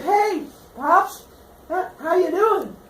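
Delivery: high-pitched, overly casual hello; high, awkwardly cheery greeting; hammed-up greeting. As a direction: play a son popping out to surprise Dad. Son pops out, greets father.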